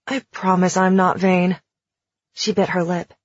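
usual speaking voice

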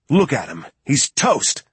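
excited, without a full shout; excited outburst. A narrator's reading. Lloyd cheering at the target on screen, excited outburst. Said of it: "shouted Lloyd with excitement".

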